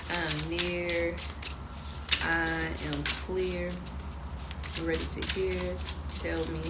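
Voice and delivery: soft, hushed chant calling on spirit; quiet rhymed invocation. Acted. Tarot reader calling on spirit before drawing cards, hushed chant. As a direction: murmur the rhyme like a small prayer.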